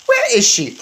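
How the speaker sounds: high, loud angry yelp; furious demand. Acted. Bruno furious about the witch, yelping where she is.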